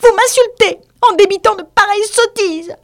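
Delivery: very high, loud offended mouse; furious squeaky mouse outburst; outraged cry. A narrator's reading. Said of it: "s'écria la souris".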